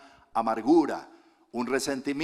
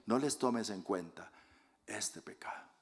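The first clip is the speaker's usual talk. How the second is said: 1 soft prayer; 3 semitones lower, 7 dB quieter than usual. Acted. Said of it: "oración de Esteban".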